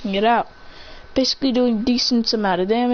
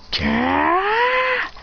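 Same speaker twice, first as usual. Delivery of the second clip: high, harsh angry gah; furious gah, pitched way up; angry outburst; 10 semitones higher than usual. Acted. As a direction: blurt it out in frustration. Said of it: "He's like".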